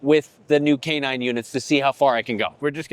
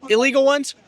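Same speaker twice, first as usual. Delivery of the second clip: mock surprise; 9 semitones higher, 3 dB louder than usual. Mock shocked echo.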